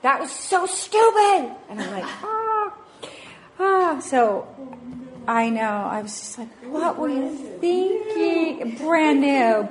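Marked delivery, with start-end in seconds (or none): 0.0-1.5 high, loud, drawn-out outburst; yelling out. Retold or imitated. Mother yelling at child over damaged new car, yelling out. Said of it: "And I yelled out". 2.2-4.0 high, loud "ah" of dismay; re-enacted wail of horror; aghast cry. Mother seeing burn in new car, aghast cry. 6.7-9.7 high, slow, loud telling-off; hammed-up outraged parent; exasperated scolding. Mother scolding child over brand new car, exasperated scolding.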